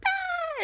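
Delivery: high mock-cry "ah"; put-on bawling yelp; fake crying wail. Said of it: "he just starts fake crying".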